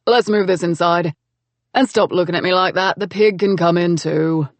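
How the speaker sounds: gruff brother-in-law, deadpan; lightly voiced Cameron. Greeting the couple at his door, mildly gruff and dry.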